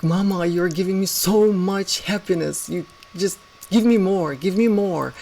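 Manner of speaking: dog talking to owner. Acted. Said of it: "that dog looks at you and like".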